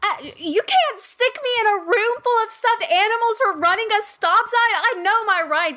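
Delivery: indignant rising protest. Acted. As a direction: demand your rights, outraged. Ramona protesting her arrest.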